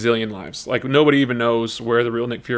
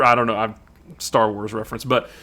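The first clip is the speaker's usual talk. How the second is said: ordinary delivery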